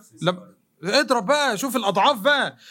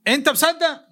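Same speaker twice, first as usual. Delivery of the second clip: normal talking voice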